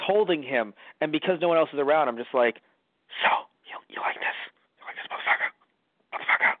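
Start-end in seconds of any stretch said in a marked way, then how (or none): 3.2-6.6 hissed, gloating whisper; menacing whisper. Acted. Light holding L as he dies, whispering terrible taunts. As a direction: whisper the taunts into a dying man's ear. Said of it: "whispering terrible shit to L".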